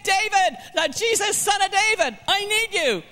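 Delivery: high, pleading cry to Jesus; desperate calling out. Retold or imitated. Blind beggar calling out high over the crowd, pleading. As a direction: call out high, begging him to come. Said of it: "here's Bartimaeus saying".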